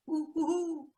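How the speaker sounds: high hooting owl call; high, repeated owl hoots to the parents. Retold or imitated. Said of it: "with the owl sound".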